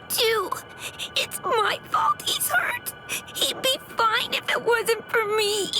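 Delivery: anguished, breaking plea full of guilt; distraught pleading. Acted. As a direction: beg tearfully for his life. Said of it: "Stop that annoying screaming".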